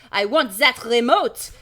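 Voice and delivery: put-on loud, slow French-accented shout; yelling. A narrator's reading. Shopkeeper demanding the gadget from fleeing kids, French-accented yell. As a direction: bellow the demand in mock French. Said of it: "he yells".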